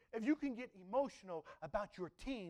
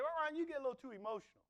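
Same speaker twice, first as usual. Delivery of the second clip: fast, higher, lightly mocking complaint; mock critics; 8 semitones higher, faster than usual. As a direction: rattle off the critics' gripe. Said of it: "they say".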